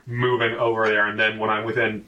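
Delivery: ordinary delivery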